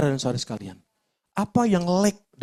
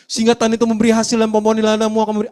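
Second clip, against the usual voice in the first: louder, fast, ringing proclamation; proclaiming scripture; 5 semitones higher, 7 dB louder, faster than usual. Acted. Preacher proclaims the scripture promise to the congregation, louder and fast. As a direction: declare the promise with full force.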